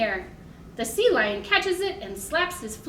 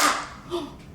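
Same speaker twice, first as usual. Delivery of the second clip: mock gasp; 3 semitones higher than usual. Shown as a demonstration. It's loud.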